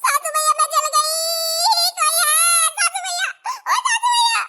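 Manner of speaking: high, loud, crying calls for help; tearful calling out. Acted. Burnt bride wails for her mother-in-law, crying.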